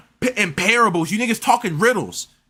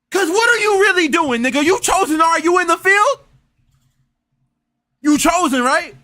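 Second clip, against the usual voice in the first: mocking challenge; 7 semitones higher, 3 dB louder than usual. Real, not acted. Mocking challenge to self-proclaimed chosen.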